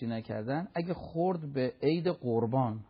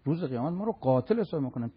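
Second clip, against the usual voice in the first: usual speaking voice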